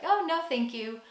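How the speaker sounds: nice intonation. Acted. Customer declining an offer at a cafe, nicely intoned. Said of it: "say it with a nice intonation".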